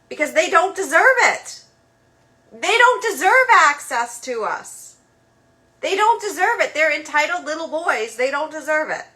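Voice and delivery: really fed up, driving the point home; emphatic outburst. Real, not acted. Fed-up rant about entitled men.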